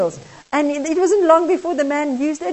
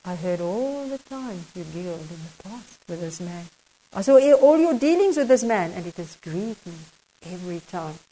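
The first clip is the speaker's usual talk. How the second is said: much softer, grieved and tender; sorrowful Jesus; 5 semitones lower, 3 dB quieter than usual. Acted. Jesus tells the kneeling man his deals saddened him, softly grieving. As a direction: let the sadness sink into a near hush.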